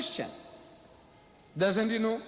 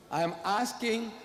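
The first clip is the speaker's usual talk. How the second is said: ordinary talk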